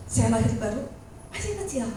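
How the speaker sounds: ordinary talk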